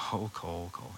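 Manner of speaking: soft, low, fond sigh of a name; like a mom gently chiding her child; a parent's soft, fond "Oh, Cole"; gentle parental sigh. Retold or imitated. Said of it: "our moms are just like".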